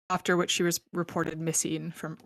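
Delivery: ordinary talk, nothing marked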